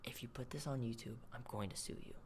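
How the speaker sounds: whispered threat, very soft; whispering. Retold or imitated. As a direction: hiss the threat under breath. Girl threatening in background.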